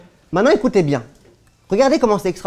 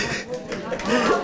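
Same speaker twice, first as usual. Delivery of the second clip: an unvoiced, airy laugh; breathy laugh; 5 semitones higher, 7 dB quieter than usual. Real, not acted. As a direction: laugh silently, just breath. Laughs after the punchline.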